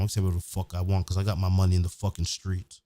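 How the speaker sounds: ordinary talk, nothing marked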